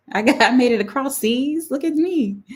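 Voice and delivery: high-pitched, delighted self-celebration; excited boast. Real, not acted. Host learns her show reached across seas, excited. Thrilled at reaching overseas viewers.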